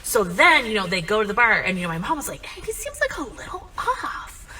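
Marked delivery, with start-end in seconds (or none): 2.5-4.5 very high, tentative little voice; hushed high aside, stretched out at the end; wary mom. Retold or imitated. Mom's wary aside in story.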